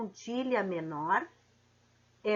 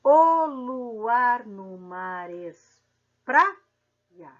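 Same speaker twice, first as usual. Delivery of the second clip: very slow, louder, syllables stretched; slow metric count; 6 dB louder, much slower than usual. Shown as a demonstration. Teacher counting metric syllables for students, very slow. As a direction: count out the poetic syllables slowly. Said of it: "vamos fazer a separação poética ou métrica".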